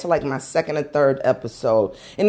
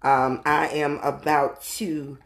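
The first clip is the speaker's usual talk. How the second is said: usual speaking voice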